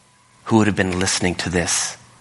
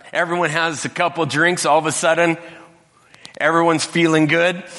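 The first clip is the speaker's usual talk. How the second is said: lively wedding host; 7 semitones higher than usual. Retold or imitated. Master of ceremony explains wedding custom.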